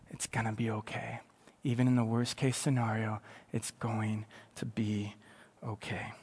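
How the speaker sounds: low, hushed, unhurried comfort; calm reassurance. Acted. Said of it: "speaks calmly to you".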